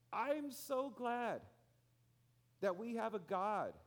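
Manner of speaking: high, loud and slow preached praise; slow emphatic preaching. Acted. Preacher praising God to the congregation, slow loud and high. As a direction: stretch each word out with fervor. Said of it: "preaching climax".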